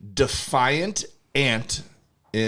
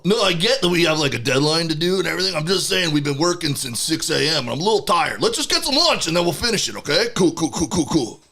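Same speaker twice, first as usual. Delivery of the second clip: lazy beaver; 6 semitones higher than usual. Acted. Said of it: "I'm the lazy beaver".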